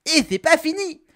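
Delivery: high, loud catchphrase burst; excited announcement. Acted. Catchphrase announcing yet another weapon. (annonce enthousiaste, aiguë et forte)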